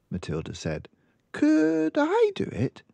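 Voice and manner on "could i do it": put-on much higher, slow and louder child's gush; girl telling librarian she loved the book; delighted little girl. Girl asks eagerly.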